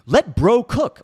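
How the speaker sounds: loud, high mocking imitation; exaggerated falsetto mockery; mocking meme-speak. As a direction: squawk the meme to ridicule it. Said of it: "They're so fucking cringe".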